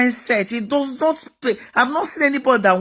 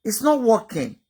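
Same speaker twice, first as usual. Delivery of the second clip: ordinary talk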